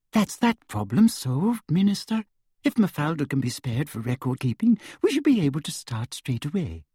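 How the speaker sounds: sugary high-pitched official; sweet, simpering and high; Umbridge voice. A narrator's reading. Umbridge voice held.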